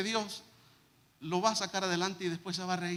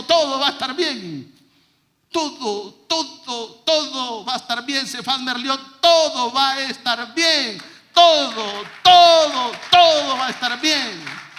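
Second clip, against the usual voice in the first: building to a full shout, slow and higher; impassioned reassurance; 5 semitones higher, 13 dB louder, slower than usual. Retold or imitated. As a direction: cry out the father's promise, louder each time. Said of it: "la carta de amor de mi papá". (promesa gritada con pasión creciente)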